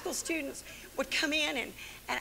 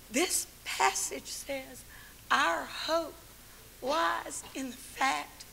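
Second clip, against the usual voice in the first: normal talking voice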